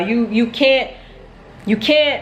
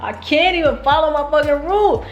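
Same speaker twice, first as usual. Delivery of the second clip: laughing through the words, pitched up; half-laugh at her own mistake; rueful laugh while talking; 6 semitones higher than usual. Real, not acted.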